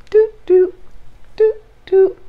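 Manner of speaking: high, loud little doot doot sounds. Pretend. Teacher turning on kids' listening ears, high playful doots. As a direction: chirp a playful switching-on noise.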